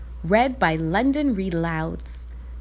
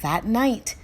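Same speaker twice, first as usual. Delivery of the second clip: plain reading voice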